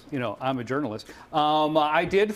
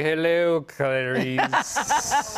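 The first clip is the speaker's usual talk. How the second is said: low, lightly menacing "Hello, Clarice"; Hannibal Lecter impression; 4 semitones lower, much slower than usual. Host greets the actress in a lightly voiced Lecter menace. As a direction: purr it like a creepy killer greeting. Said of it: "that's actually how the producers told me".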